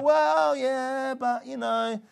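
high, drawn-out hedging; mock reluctant agreement. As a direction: imitate someone unconvinced stalling. Imitating a doubtful, hesitant listener.

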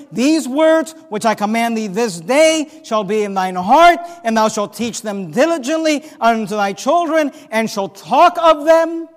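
loud, weighty Bible reading from the pulpit; emphatic scripture reading. Shown as a demonstration. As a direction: hammer each phrase of the verse. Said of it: "Notice the emphasis on time".

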